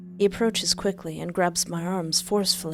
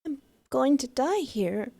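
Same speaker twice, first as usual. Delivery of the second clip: despairing; 7 semitones higher than usual. Acted. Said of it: "I tell myself".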